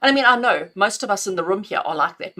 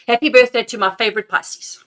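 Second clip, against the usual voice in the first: bright loud happy birthday; excited birthday wish; 3 semitones higher, 8 dB louder than usual. Real, not acted.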